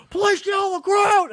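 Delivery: high, loud mock police shout; intense cop. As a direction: yell it like an officer charging in. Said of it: "these dudes are intense".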